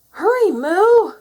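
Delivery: high, loud, drawn-out plea; urgent cry. A narrator's reading. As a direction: cry out to hurry a friend. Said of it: "cried Minnie".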